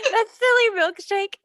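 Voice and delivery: high, loud, giggly pet-talk; playful high-pitched scolding of a pet; amused cooing. Real, not acted. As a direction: tease the cat through real amusement. Amused, talking to the cat.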